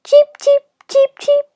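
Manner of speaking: very high, tiny chick peeps; duckling cheeps. A narrator's reading. Hatching ducklings peeping from their shells, high cheeping. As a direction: squeak like newly hatched ducklings.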